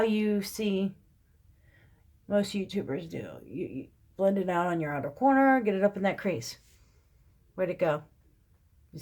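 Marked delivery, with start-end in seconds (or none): none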